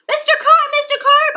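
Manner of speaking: high, loud yell for Mister Carp; boy yelling into the water. A narrator's reading. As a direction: call out worried into the pool. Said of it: "I yelled".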